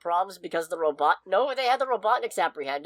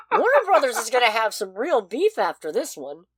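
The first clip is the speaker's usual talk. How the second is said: usual speaking voice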